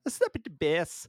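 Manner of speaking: high, goofy catchphrase impression; Paul Rudd. Host re-enacts Rudd's bit for the co-host, high goofy voice. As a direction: imitate an actor's silly improvised bit. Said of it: "and he's just like".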